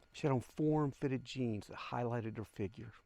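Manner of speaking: ordinary delivery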